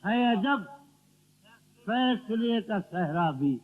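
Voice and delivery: declaimed verse with pauses after key phrases; ghazal recitation. Acted. Poet reciting to his friends at a mushaira, declamatory recitation. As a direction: offer each line to the audience.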